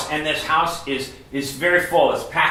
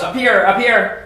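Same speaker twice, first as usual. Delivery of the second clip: raised, higher shout to friends below; yelling; 6 semitones higher, 7 dB louder than usual. Acted. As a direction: call out loudly from a rooftop. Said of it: "Imagine he yells".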